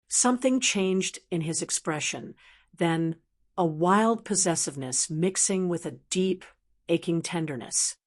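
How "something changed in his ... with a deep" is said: ordinary delivery, nothing marked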